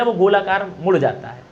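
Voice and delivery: normal talking voice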